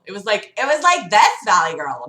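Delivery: put-on fast, lilting Valley Girl voice; mild Valley Girl demo; Valley Girl cadence. Shown as a demonstration. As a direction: show this kind of Valley Girl. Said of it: "cadences of Valley Girl".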